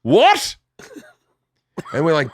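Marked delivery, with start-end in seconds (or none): 0.0-0.6 high, abrupt "can't hear you" call; hard-of-hearing dad. Retold or imitated. Dad without hearing aid answering the funeral director, loudly puzzled. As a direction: imitate a deaf old dad calling out. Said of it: "doesn't have his hearing aid in".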